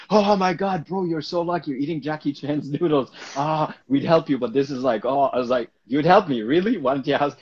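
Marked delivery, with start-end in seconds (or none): 0.1-5.2 higher, louder mock-excited friends; teasing friends. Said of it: "my friends, are like". 5.9-7.4 louder, higher disbelieving comeback; incredulous retort. Retold or imitated. Retorting to teasing friends.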